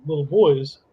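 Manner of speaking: ordinary delivery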